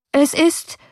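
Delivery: high, broken-off start; choked by fright. A narrator's reading. Coachman to his passengers, choked by fright. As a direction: voice catches with fright. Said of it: "der Schrecken erstickte die Stimme".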